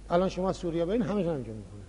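ordinary talk

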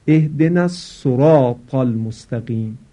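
الصراط as sorāt, thin s and u vowel; wrong sorāt. Shown as a demonstration. Teacher imitating the common wrong recitation. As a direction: recite the verse saying sorāt.

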